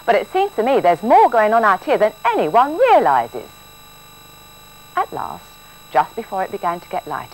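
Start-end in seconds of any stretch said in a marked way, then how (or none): 0.0-3.5 grumbling. A narrator's reading. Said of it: "she grumbled".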